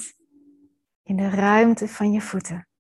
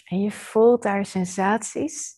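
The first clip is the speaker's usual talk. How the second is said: normal talking voice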